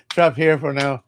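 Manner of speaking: normal talking voice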